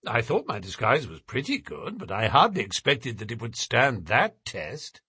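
ordinary talk

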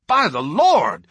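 loud, slow, heated oath; hotly. A narrator's reading. Lawyer angry at the trip refused, heated oath. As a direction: let the anger flare on it. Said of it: "he added hotly".

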